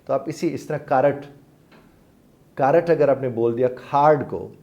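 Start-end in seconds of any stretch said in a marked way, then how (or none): 0.8-2.9 کارٹ as two syllables, "ka-rat", for card; wrong pronunciation. Shown as a demonstration. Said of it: "وہ تو pronunciation ہی ٹھیک نہیں ہے". 3.7-4.3 کھارڈ with a strong puff on the K; accented pronunciation. Shown as a demonstration. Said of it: "pronunciation plus accent آ گیا".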